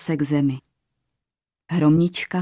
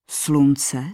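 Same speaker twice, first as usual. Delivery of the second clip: plain reading voice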